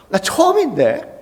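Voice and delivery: high, slow, incredulous question; acted disbelief, drawn out and high; wronged protest. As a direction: protest like a falsely blamed pupil. Pupil's wronged reaction, acted out.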